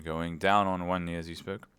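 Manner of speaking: ordinary delivery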